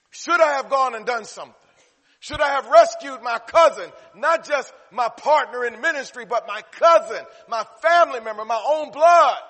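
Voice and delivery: loud, high, impassioned preacher's outcry; acted anguish at full pulpit volume; anguished self-questioning. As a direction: cry out in building self-reproach, punching each relation. Preacher voices Jesus anguished over cousin.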